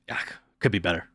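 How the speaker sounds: lightly voiced low, weary cashier; night-store small talk. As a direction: mumble it low like a night-shift worker. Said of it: "when I go to the store at night".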